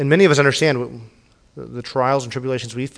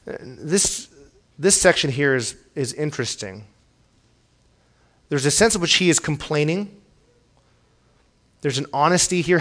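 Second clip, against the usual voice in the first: ordinary talk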